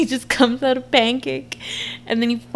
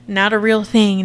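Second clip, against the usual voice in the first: normal talking voice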